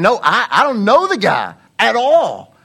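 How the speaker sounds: loud, high, hammed-up protest; adamant denial. Peter fighting off his third accuser, adamant. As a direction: stammer into an emphatic acted shout. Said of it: "he gets more adamant every single time".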